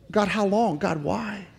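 soft, hushed plea to God; quiet lament. Retold or imitated. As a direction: pray it barely above a hush. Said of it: "take it to the right person, God".

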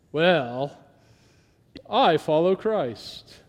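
slow smug pious voice, lightly done; mock super spiritual. Mocking the super spiritual churchgoer, slow and smug. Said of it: "Don't you love that guy? That super spiritual person?".

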